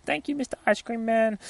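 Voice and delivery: put-on little girl thanks the ice cream man, high and sweet. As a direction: squeak it like a polite small girl. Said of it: "she's like".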